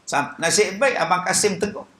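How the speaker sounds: normal talking voice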